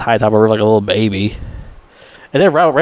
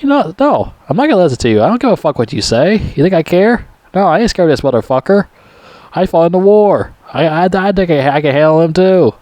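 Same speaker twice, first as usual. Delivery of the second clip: higher-pitched mock defiance; defiant retort; 6 semitones higher than usual. Brian Denny refusing to listen to the colonel, defiant. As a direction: act out a cocky refusal to back down. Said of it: "And Brian Denny's like".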